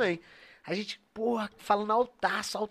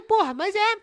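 squeaky mock voice; 11 semitones higher, 5 dB louder than usual. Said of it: "ele falou".

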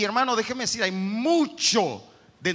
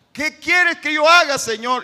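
louder, higher pleading cry to the Lord; fervent cry; 6 semitones higher, 9 dB louder than usual. Acted. Preacher voicing Paul's surrender, crying out loud. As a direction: throw the question up to heaven.

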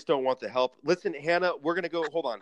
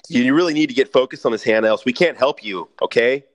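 loud scolding; 12 dB louder than usual. Acted. Host scolds distracted caller.

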